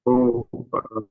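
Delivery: usual speaking voice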